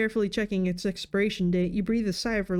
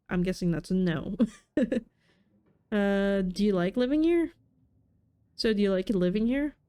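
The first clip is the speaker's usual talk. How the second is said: normal talking voice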